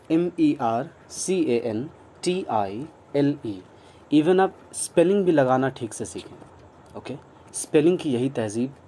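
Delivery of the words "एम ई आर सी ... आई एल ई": slow spelling out. Shown as a demonstration. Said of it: "letters spelled".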